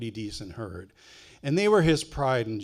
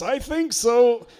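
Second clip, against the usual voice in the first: much higher, slow, strained through laughter; Jerry in pain; 11 semitones higher, 7 dB louder, slower than usual. Retold or imitated. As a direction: squeak it out with a hurt knee. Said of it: "And Jerry says".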